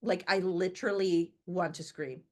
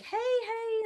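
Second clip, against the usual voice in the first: bright greeting. Retold or imitated. Greeting appraiser at the door.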